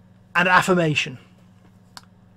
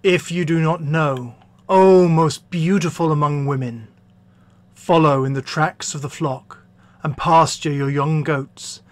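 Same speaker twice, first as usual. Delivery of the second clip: slightly lowered, firm lover's voice; man in love; 2 semitones lower than usual. Acted. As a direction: address the beloved with measured ardour.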